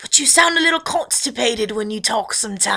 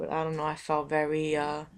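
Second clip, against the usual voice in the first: ordinary talk, nothing marked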